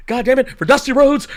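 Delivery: high, punchy mock sign-off; outraged commentator. Imitating the announce team signing off after the betrayal, mock outrage. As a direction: announce it in comic disgust.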